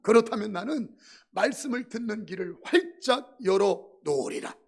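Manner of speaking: full-force preaching. Acted. Pastor exhorts the congregation at full volume.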